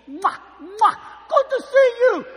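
high, loud, effusive mock stepmother; gushing greeter. Stepmother grabs the pastor's cheeks, gushing. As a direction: shout a greeting between smacking kisses.

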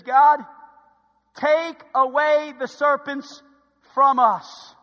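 slow, loud call to God; loud pleading prayer. Acted. Moses praying for the bitten people, loud pleading prayer. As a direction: plead with heavy weight on each word. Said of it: "So here's what Moses does. He says".

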